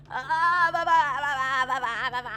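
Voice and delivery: long babbled wail, falling in pitch; frustrated babbling. Pretend. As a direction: babble as if losing it.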